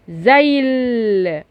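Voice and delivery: زيل very slowly with a long held final L; final L demonstration. Shown as a demonstration. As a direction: stretch زيل right through the L. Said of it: "نطقت اللام فين بقى؟". (مد اللام في آخر زيل)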